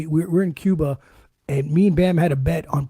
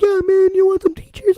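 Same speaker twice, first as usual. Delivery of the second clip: high-pitched, louder imitation of someone offering a drink; high mock-enthusiastic quote; mock eager offer; 12 semitones higher, 3 dB louder than usual. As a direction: pitch it way up, like a pushy pal. Imagined person offering a drink.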